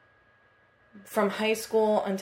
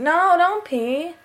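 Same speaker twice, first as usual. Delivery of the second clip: high, drawn-out whiny plea; protesting; 10 semitones higher, 7 dB louder, much slower than usual. Real, not acted. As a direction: stretch it out as a high-pitched protest. Pleading as listener leaves.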